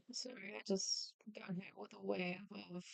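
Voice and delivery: barely voiced, breathy hush; overcome whisper. Real, not acted. Artist overcome by love for the character, whispering. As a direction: trail off in a whisper.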